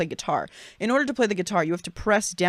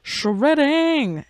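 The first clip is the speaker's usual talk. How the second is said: high, drawn-out shout of glee; gleeful exclamation; 8 semitones higher than usual. Real, not acted. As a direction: stretch it out and swoop up high. Gleefully echoing the term just read.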